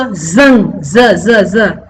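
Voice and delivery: जंग with buzzing z, then "za, za, za"; z-sound demo. Shown as a demonstration. Teacher isolating the sound of जंग, a buzzed z. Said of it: "ए वाले जंग का मतलब".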